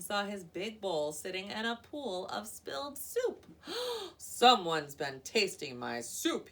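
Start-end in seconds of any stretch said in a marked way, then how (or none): 3.5-4.1 shocked gasp. Acted. Papa Bear discovers spilled soup. 4.2-6.5 slow, loud bear roar. A narrator's reading. Papa Bear roaring over his spilled soup. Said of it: "he roared".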